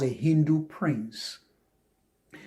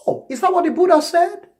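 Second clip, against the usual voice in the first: high, louder polite query; nice correction; 14 semitones higher, 5 dB louder than usual. Acted. Answering someone who calls Buddhism atheism, nicely high-pitched query. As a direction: question them nicely in a raised pitch. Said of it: "You nicely kind of correct it".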